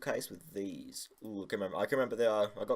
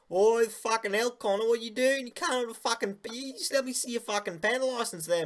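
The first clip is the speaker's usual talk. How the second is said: mock Aussie cop; 11 semitones higher, 5 dB louder than usual. Cop busting a seven-year-old without pen license, shrill mock outrage. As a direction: squeal it like an outraged Aussie copper.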